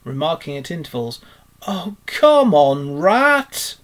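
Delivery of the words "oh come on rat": drawn-out, loud impatient whine rising high. A narrator's reading. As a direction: whine loudly for a friend to hurry. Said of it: "waited impatiently".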